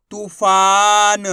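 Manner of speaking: तूफान very slow and loud, vowels held long; drawn-out vowels. Shown as a demonstration. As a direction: stretch both तू and फा in तूफान. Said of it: "खींच के पढ़ेंगे".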